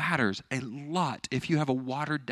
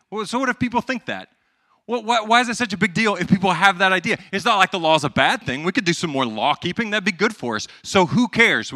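higher-pitched, insistent pushback, acted out; listener's objection; 6 semitones higher, 6 dB louder than usual. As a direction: voice a sceptical objector, higher and louder. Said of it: "So you might say".

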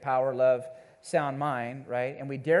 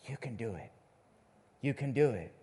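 quiet encouragement; 6 semitones lower, 7 dB quieter than usual. Shown as a demonstration. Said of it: "takes boldness to put courage".

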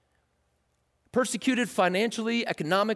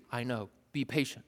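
much softer, unbothered; calm reply; 7 semitones lower, 9 dB quieter than usual. Pretend. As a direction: answer quietly, unmoved. James replies calmly.

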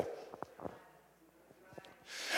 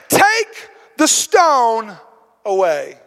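solemn command; much slower than usual. Acted. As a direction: give the order slowly, with weight. Jesus commands at the tomb.

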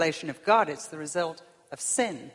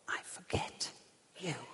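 soft, halting whisper; whispered rejection; 4 semitones lower, 11 dB quieter than usual. Acted. God forgetting the sinner at judgment, whispered. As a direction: whisper it with a pause before the last word. God's other verdict, whispered.

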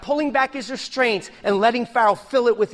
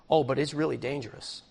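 low, ominous warning, softer; a hammed-up foreboding voice; mock warning; 7 semitones lower, 7 dB quieter than usual. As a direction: drop low like a spooky caution.

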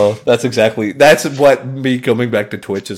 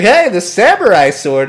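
higher, louder, slow salesman voice; hammy pitchman; 6 semitones higher, 5 dB louder than usual. Retold or imitated. Imitating the sword infomercial host, hammed-up pitch. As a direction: sell the sword with mock gusto. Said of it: "He's like".

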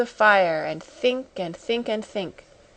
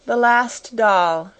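mournful; 2 semitones higher, 4 dB louder, slower than usual. A narrator's reading. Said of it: "her little mournful voice had an odd sound".

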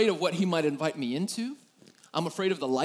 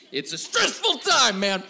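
emphatic exasperation; 7 semitones higher, 9 dB louder than usual. Real, not acted. Pastor to the crowd, loud exasperated plea. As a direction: draw it out in mock despair. Joking about pregnant wife moods.

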